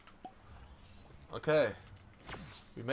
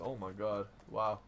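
ordinary talk, nothing marked

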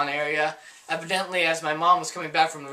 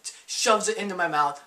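normal talking voice

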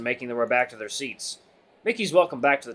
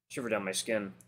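ordinary delivery

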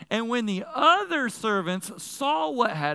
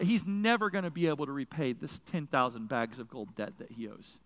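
ordinary delivery

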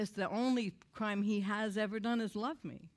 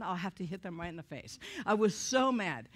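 ordinary talk

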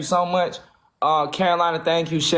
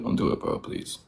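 whispered plea, much softer; begging whisper; 8 dB quieter than usual. Real, not acted. Streamer pleading with chat not to lose the mob, whispered begging. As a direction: beg under your breath.

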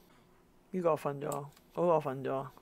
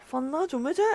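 higher, half-crying whine; mock whimper; 9 semitones higher than usual. Begging the woken baby to sleep, mock tearful and higher. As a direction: whimper it like you're near tears.

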